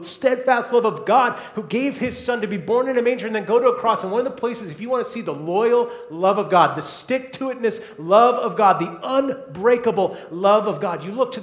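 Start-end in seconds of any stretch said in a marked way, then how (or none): none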